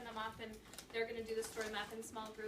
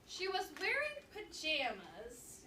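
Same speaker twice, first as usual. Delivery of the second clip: lively read-aloud narration for a class; story read-aloud; 4 semitones higher, 3 dB louder, slower than usual. Acted. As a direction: read the chapter to second graders with energy.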